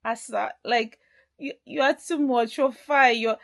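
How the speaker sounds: hammed-up smitten man; imitating Odogwu. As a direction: boyfriend gushing over his girlfriend, mock-wild. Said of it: "He's like".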